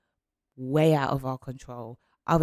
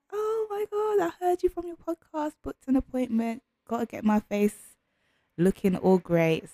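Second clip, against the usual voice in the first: high gushing fan voice; excited client; 10 semitones higher than usual. Pretend.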